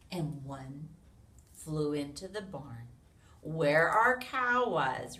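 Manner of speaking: loud, lively storybook read-aloud; animated picture-book narration; reading to children. A narrator's reading. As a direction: read it big for a room of little kids.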